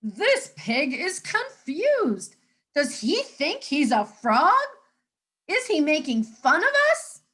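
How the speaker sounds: be insulted on the frogs' behalf; huffy frog voices; indignant frogs. Acted.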